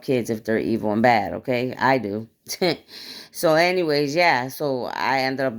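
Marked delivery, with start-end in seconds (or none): 2.5-3.3 a short airless laugh, up high; breathy laugh. Real, not acted. Amused at her own joke to viewers, short breathy laugh. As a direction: laugh it off under your breath.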